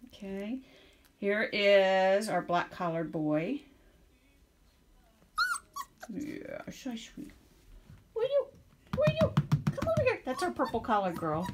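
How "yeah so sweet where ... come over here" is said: high calling to a puppy. Real, not acted. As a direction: call the puppy sweetly.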